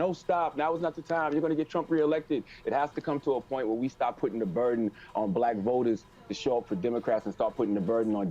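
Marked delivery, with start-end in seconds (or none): 0.0-2.4 lightly voiced objector, pitched higher; imitated objectors. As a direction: mimic worried naysayers in a higher voice.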